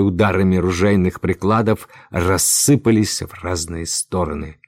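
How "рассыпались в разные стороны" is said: ordinary delivery, nothing marked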